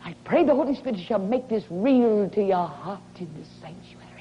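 usual speaking voice

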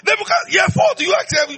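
high ringing shout of the question; calling shout. Acted. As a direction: shout the demand across the years. Preacher voicing God's yearly question.

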